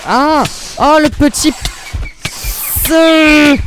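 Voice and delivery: sudden loud, high cry of surprise; startled yelp. Real, not acted. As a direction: jump as you're hit out of nowhere. Attacked suddenly in game.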